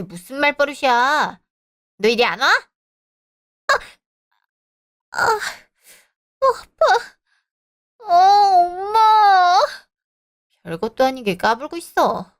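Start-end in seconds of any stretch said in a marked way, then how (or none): 3.7-7.2 high pained yelps as her hair is pulled; crying out in pain. Acted. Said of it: "머리 한번 잡은 거 가지고 그렇게 울면". 8.0-9.6 slow, high, louder sobbing call for mother; sobbing for mom. Acted. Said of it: "그렇게 울면 세상 어떻게 살아가려고".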